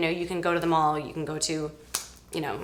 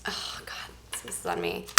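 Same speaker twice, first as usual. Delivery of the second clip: whisper; 11 dB quieter than usual. Real, not acted. Nervous before tasting bad candy.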